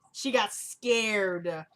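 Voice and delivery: "scared" stretched out very slowly; drawn-out emphasis. Real, not acted. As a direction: drag out the word "scared" for comic emphasis. Same remark repeated for emphasis.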